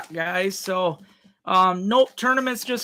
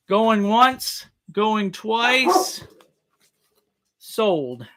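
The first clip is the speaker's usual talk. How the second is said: louder auctioneer calling out the bid; 3 semitones higher, 4 dB louder, much slower than usual. Real, not acted. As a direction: call the final bid out loud to the room. Auctioneer closing the bidding.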